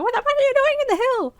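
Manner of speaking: very high, loud mock yell; imitated yelling mom. Player imitating her mom yelling, high loud screech. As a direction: shriek it like a mom telling you off. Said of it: "She'd be yelling the fuck at me".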